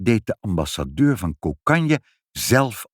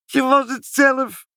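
very high, drawn-out exclamation; squealed accusation, stretched out; gleeful cry; 4 dB louder than usual. A narrator's reading. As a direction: crow it in amused disbelief. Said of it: "riepen ze".

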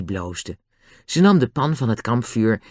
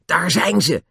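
higher-pitched excited announcement; elated; 6 semitones higher, slower than usual. A narrator's reading. Julian spots the hills at last, elated. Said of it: "zei Julian uitgelaten".

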